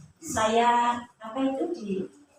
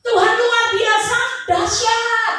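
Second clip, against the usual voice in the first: much higher and louder, hammed-up enthusiasm; mock cheerful answer; 9 semitones higher, 6 dB louder, slower than usual. Preacher mocks believers' easy answers, hammed-up cheer. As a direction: mimic the glib churchgoer's easy faith. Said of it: "Gitu jawabannya".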